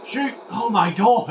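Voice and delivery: high shout then loud groan; real gamer outburst; excited yell. Real, not acted. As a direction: urge a shot, then despair. Near miss in match.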